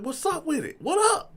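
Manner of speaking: very high-pitched retort to a rival; hyped-up squaring-off reply; acted comeback challenge. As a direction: throw the challenge back, voice leaping up. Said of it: "I'm like".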